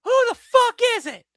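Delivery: high-pitched loud yell through a door; yelled retort. Retold or imitated. Customer yelling at the pizza guy at the door, angry high yell. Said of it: "having that response given to me".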